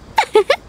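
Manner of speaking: short bright giggle; a quick laugh to brush it off; light laugh. Acted. Brushing off talk of payment with a giggle.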